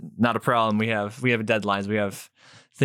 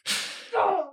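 high hammed-up sob; mock sobbing; 4 dB quieter than usual. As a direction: sob like a ruined fan. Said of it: "going through these phases of grief".

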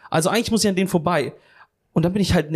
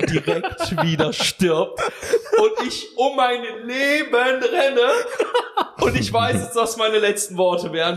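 higher and slow, laughing through the words; 7 semitones higher, slower than usual. Real, not acted. Telling friends how he ran from the dog, laughing. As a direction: laugh at yourself while telling it.

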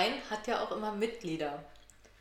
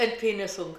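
loud laughter through words; laughing; 2 semitones higher, 10 dB louder, faster than usual. Real, not acted. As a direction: laugh as you say it. Laughs at Entpenisung.

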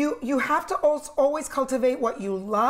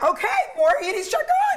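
excited yes; 11 semitones higher, 6 dB louder than usual. Retold or imitated. Agreeing to book more nights.

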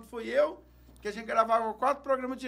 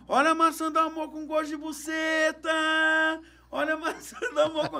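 vendor's call taught; 7 semitones higher than usual. Retold or imitated. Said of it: "fala assim".

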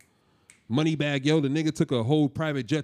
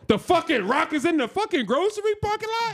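high-pitched, disbelieving rant; worked-up, high-voiced protest; incredulous outburst; 13 semitones higher than usual. Real, not acted. Heated banter over casting.

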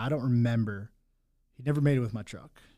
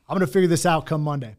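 higher and louder, tipsy promise; higher, louder cocky boast; 6 semitones higher, 9 dB louder than usual. Retold or imitated. Man retelling his drunken Monday vow, cocky and loud. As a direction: boast it like the problem is already solved.

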